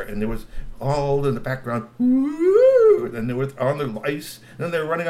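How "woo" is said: loud high hooting woo; Sasquatch call. A narrator's reading. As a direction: hoot like a wild creature.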